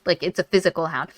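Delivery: ordinary delivery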